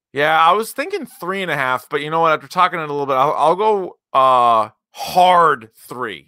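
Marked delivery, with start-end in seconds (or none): none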